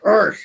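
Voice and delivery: loud, high "argh"; mock outburst of frustration; imitated exasperated growl.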